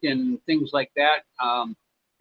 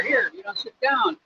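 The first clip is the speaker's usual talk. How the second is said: kind offer; 10 semitones higher than usual. Retold or imitated. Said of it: "bring you out a chair".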